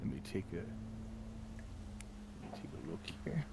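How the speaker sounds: quiet murmur. Real, not acted. Reading the line quietly.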